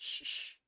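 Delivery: whispered shushing; hushing shh. A narrator's reading. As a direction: hush him quickly.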